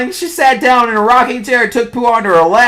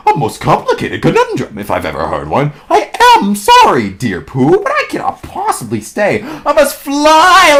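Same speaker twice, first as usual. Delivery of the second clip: fussy storybook owl; Owl's voice; 6 semitones higher than usual. Acted.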